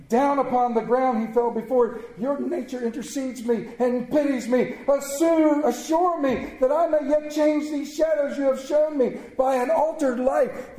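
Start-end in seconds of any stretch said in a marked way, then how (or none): 2.2-10.8 high, impassioned begging; pleading at a raised pitch; desperate entreaty. A narrator's reading. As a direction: implore the spirit for another chance. Scrooge begs for altered life.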